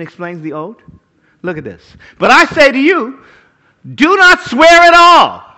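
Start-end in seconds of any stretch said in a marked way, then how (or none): 2.2-5.5 high, loud, slow proclamation; preaching at full force. Acted. Preacher voicing Jesus to the congregation, loud and slow. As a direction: thunder the command from the pulpit.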